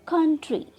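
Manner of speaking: "Country" with कं high and loud; first-syllable stress. Shown as a demonstration. Teacher showing the consonant-or-Y rule, कं stressed in "country". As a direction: punch कं, let tree fall in "country". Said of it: "first syllable को trust किया है".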